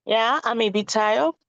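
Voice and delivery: ordinary talk, nothing marked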